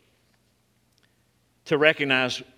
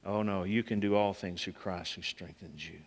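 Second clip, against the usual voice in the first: gentle voice; 7 semitones lower, 10 dB quieter than usual. Retold or imitated. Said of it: "gentle voice on the inside".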